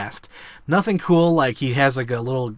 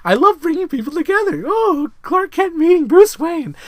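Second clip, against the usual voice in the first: high-pitched, louder, hammed-up villain voice; mock Lex Luthor; 6 dB louder than usual. Retelling the trailer, Lex Luthor greets both heroes, high and gleeful. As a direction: squeal it like a gleeful schemer. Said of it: "Lex Luthor comes up".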